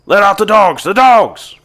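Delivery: loud, high barked order; Harry shouts. Acted. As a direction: shout the order to release the hounds. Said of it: "you hear Harry shout out".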